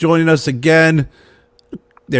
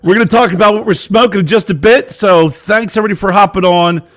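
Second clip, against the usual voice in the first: show host intro; 3 semitones higher, 4 dB louder than usual. Acted. Welcoming viewers to Stogie Road Diaries, host announcer voice.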